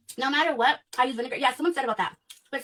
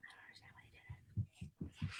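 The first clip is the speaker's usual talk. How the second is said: barely audible; 22 dB quieter than usual. Real, not acted. Near tears, voice gone.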